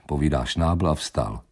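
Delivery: ordinary delivery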